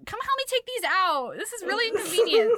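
put-on high, loud whiny boyfriend; hammed-up whine with arrows in his chest; whiny complaint. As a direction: complain like a spoiled lover. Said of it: "And he's like".

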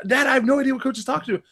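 put-on high, fast, protesting kid voice; lightly voiced flustered boy; imitating a panicked kid. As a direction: rush it like a confused teen. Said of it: "and Keontae".

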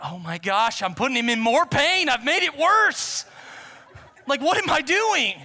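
high, loud, mounting alarm; panicked dismay. Acted. Preacher reliving thinking he worsened the man's pain, loud panicked dismay. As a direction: build from shock to a stretched high cry.

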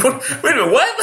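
high incredulous what; baffled surprise. Retold or imitated. Comic baffled by an odd invite, high surprise. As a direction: react stunned and confused.

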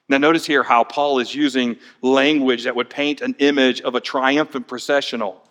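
normal talking voice

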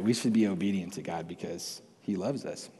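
ordinary delivery

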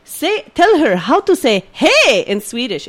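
put-on slow, lightly Swedish-accented fatherly prompt; Swedish dad. Father prompts his son, slow Swedish accent. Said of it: "he's like".

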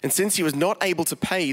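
ordinary delivery

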